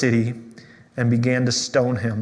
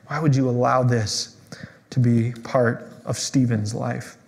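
hushed lament; 5 dB quieter than usual. Real, not acted. Pastor asking God why, hushed and pained. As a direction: drop into a quiet, pained plea.

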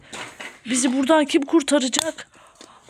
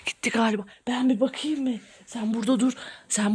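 low, breath-only whisper; 3 semitones lower, 6 dB quieter than usual. Pretend. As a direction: whisper cautiously from hiding. Peeking out from hiding.

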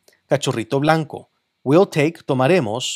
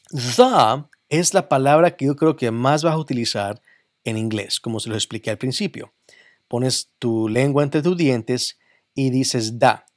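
normal talking voice

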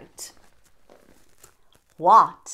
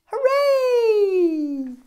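much higher, louder held cheer; cheering hooray; 13 semitones higher, 6 dB louder than usual. Acted. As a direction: cheer for the cake. Cake arrives at party.

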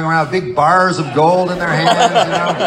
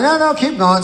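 high coaxing; 12 semitones higher than usual. Retold or imitated. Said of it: "I'm like".

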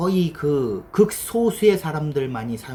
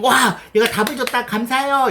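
much higher, louder thrilled thanks; an overjoyed, high, loud thank-you; mock delighted; 10 semitones higher, 6 dB louder than usual. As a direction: gush like an answer just arrived. Delighted at getting an answer.

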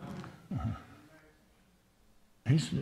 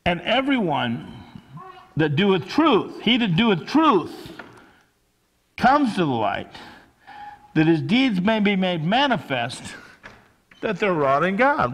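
loud, measured Bible reading; scripture reading; 5 semitones higher, 6 dB louder than usual. Acted.

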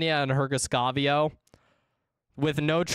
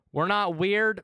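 ordinary talk, nothing marked

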